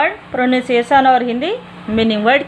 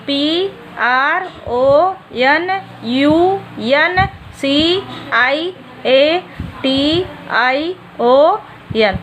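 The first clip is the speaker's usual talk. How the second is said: letters spaced far apart, very slow; slow spelling; much slower than usual. Shown as a demonstration. Teacher spelling a long word for the class, very slowly. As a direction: dictate each letter so children can copy. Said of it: "एक बार फिर से देख लीजिए".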